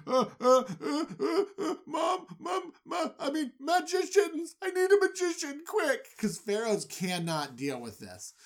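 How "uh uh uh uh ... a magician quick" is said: high, stammering frightened calling; a scared child crying for mom; panicked whimper. Retold or imitated. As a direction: pharaoh waking terrified, calling for magicians. Panicked pharaoh calling for help.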